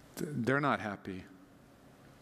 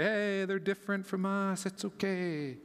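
higher, mock-tolerant voice; easygoing dismissal; 6 semitones higher than usual. Church people excusing a different teacher, mock easygoing. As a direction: wave it off lightly, imitating the easygoing.

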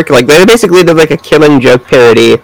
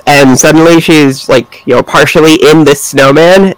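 ordinary delivery

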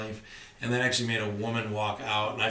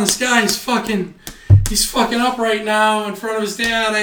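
laughing; 9 semitones higher, 11 dB louder than usual. Retold or imitated. Laughing at podcast on plane.

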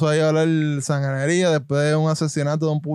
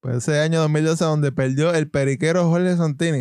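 ordinary talk, nothing marked

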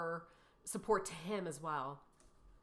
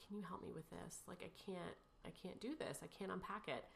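much softer, quiet retold plea; retold helpless plea; 12 dB quieter than usual. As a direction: quietly imitate asking for help. Helpless plea to mother-in-law.

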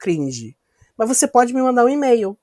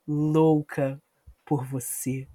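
low, slow and soft; seductive whisper; 6 semitones lower, 8 dB quieter, much slower than usual. Acted. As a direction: draw out each word, sultry. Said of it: "esse não sou eu cantando pra Tainá".

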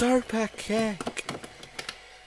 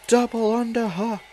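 normal talking voice

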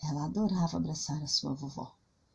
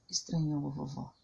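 ordinary delivery, nothing marked

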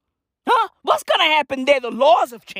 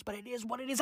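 normal talking voice

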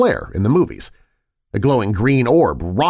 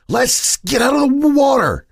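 desperate; 3 dB louder than usual. A narrator's reading. Freezing, desperate.

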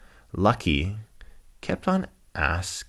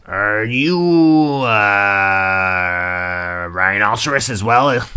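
put-on slow, loud old rhino with drawn-out words; Rex; 3 semitones higher, 9 dB louder, much slower than usual. Old rhino questioning a stranger, slow put-on voice. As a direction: stretch the question out like a cantankerous old beast. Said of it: "grumpy old cantankerous rhinoceros".

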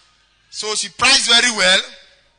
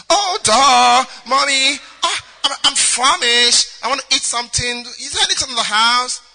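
put-on slow, drawled foreign-schooled accent, louder; returnee put-on accent; 2 semitones higher, 5 dB louder than usual. Daughter back from abroad asking mommy for food, put-on accent. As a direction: ham up the posh abroad accent. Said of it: "how they do when they don't go school for abroad".

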